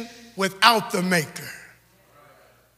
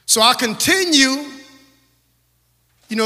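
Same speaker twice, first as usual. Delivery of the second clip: usual speaking voice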